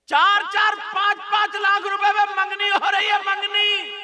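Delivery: ringing, sustained preacher's chant; full-force preaching. Real, not acted. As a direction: preach it at full volume, stretching key words.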